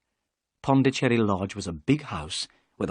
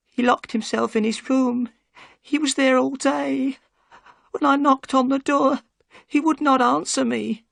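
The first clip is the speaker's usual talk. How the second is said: put-on much higher, old woman's fearful voice; trembling old housekeeper; frightened housekeeper; 4 dB louder than usual. A narrator's reading. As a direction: plead with relief and fear.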